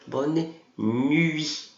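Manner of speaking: "Bonne nuit," with lips pushed forward on the U of "nuit"; teacher shows students the pout for "Bonne nuit,"; rounded French U. Shown as a demonstration. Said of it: "Tem que fazer o biquinho".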